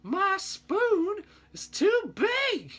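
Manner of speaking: squeaky high and very slow cartoon line; cartoon impersonation. Teacher impersonating a rejected cartoon, squeaky high and slow. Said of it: "pretty good impersonation".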